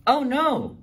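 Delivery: high, loud cry of alarm; alarmed call. Acted. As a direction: call out in sudden worry. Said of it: "Phoebe called".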